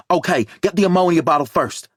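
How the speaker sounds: high, loud urgent direction; tense medic giving orders; urgent instruction. A narrator's reading. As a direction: call out the order fast and firm. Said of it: "Linda says".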